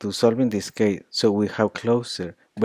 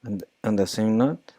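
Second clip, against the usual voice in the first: ordinary talk, nothing marked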